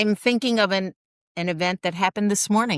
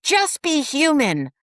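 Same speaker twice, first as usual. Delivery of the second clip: higher, slow exasperated mock yell with each word dragged; pleading yell, pitched up and drawn out; retold yell; 9 semitones higher, slower than usual. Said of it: "and I scream at her".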